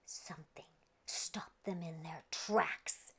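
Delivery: slow hushed storyteller whisper; a slow spooky whisper; suspense whisper. A narrator's reading. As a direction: drop to a whisper to build suspense.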